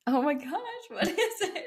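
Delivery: high laughing exasperation; laughing, stumped. Real, not acted. Cohost stumped by the quiz, laughing and high. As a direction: squeal it out, stumped.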